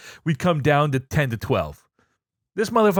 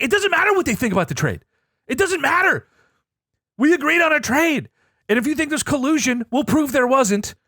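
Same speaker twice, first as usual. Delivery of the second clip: heated insistence; 9 semitones higher than usual. Retold or imitated. Retold argument, heated.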